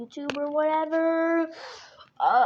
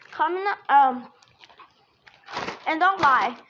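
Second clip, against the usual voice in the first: normal talking voice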